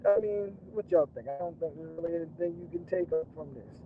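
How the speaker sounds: normal talking voice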